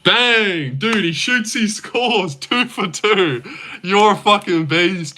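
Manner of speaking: high, drawn-out sportscaster call; NBA commentator. Acted. Streamer hyping a viewer like an NBA commentator, high excited call. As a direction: call it like a huge basket just went in. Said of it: "that famous N B A commentator".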